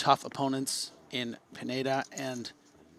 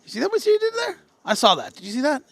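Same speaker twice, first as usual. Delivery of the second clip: high, loud mock outrage; incredulous taunting; 13 semitones higher, 7 dB louder, faster than usual. Real, not acted. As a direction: call him out, playfully indignant. Mock taunt toward player at table.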